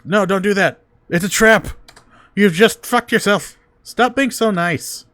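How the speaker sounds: shouting. Real, not acted. Player yelling warnings at character.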